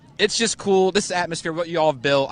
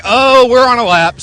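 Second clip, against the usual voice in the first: louder, higher, slow "oh, we're on a lap" as if it just hit him; surprised realization; 7 semitones higher, 9 dB louder than usual. Retold or imitated. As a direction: replay the jolt of realizing it counts, higher and louder. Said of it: "I'm like".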